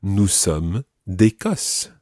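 slow, careful French; deliberate classroom French; slow teaching pace. Shown as a demonstration. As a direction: say each word clearly for learners. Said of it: "Now watch that".

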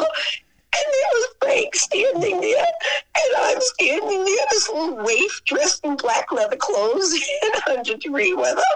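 high, tearful storytelling voice; choked up. Real, not acted. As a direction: tell it high, close to tears and laughing. Emotional memory of meeting Frank again.

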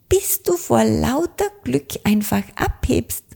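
high, lively little witch, excited. A narrator's reading. Little witch cheers the blackbird on, excited. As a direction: chirp encouragement like an eager young witch.

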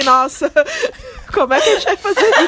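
high, fast and laughing. Retold or imitated. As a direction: burst out laughing mid-line. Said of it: "Eu falei assim".

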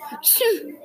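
small high squeaky sneeze; a little puppy achoo. A narrator's reading. Puppy sneezing in the car, cute sneeze. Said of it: "The puppy sneezed".

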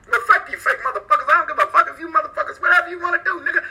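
a hammed-up fake blow-up, all in fun; mock spazzing. Streamer pretending to spaz on his haters, mock angry. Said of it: "Y'all want me to spaz?".

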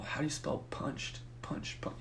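very hushed, whispered puzzling; muttering to oneself. Retold or imitated. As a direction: whisper it to yourself as your mind blanks. Blanking while filling out form.

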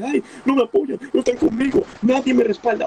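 mocking a whiner, pitched high; mock whining. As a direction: mimic a crybaby griping that nobody backs him. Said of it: "llorar que".

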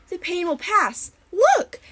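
delighted to show off her work; a witch thrilled by her spell; gleeful sorceress. A narrator's reading. Cece showing the transformation.

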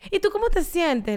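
high, slow, syrupy gentle-parenting voice; sugary parent. Pretend. Mother mocks gentle parenting advice with a sugary baby voice. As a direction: overdo the caring question to a baby.

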